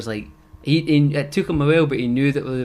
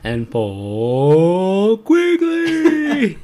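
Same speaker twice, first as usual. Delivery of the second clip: very slow, high, loud stretched-out host voice; announcer intro; 6 semitones higher, 8 dB louder, much slower than usual. Pretend. Finishing the podcast intro, drawn-out loud announcer voice. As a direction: hold the name out like a game show host.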